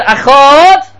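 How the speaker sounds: loud, high, long-held rising word; drawn-out prayer cry. Retold or imitated. Dying rebbe saying his last word, drawn-out prayer cry. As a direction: call it out and stretch it, rising.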